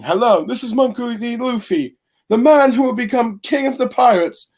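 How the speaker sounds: high, bouncy cartoon hero announcing himself; cheery Luffy voice. Reviewer voicing Luffy introducing himself to the elders, high and bouncy. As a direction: chirp it out like a boastful boy captain. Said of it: "Luffy starting out the conversation by saying".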